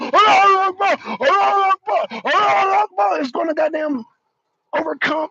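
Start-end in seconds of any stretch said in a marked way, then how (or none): 0.0-3.2 higher, louder chanted yell; mock battle cry. Mocking M D's idea of fighters charging, loud chant. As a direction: shout it like a reckless fighter. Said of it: "run around".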